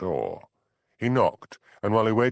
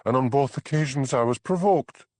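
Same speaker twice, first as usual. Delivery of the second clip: defiant; 6 semitones higher, 4 dB louder than usual. A narrator's reading. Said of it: "she said defiantly".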